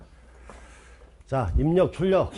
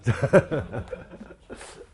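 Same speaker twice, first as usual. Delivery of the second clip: breathy laugh trailing out; amused laugh. Real, not acted. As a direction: laugh at your own odd demonstration. Laughs at own odd demonstration.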